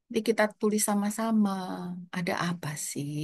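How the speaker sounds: put-on gently de-escalate an upset teenager; retold patient parent, hushed and unhurried; speaking softly. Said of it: "saya justru ngomongnya pelan".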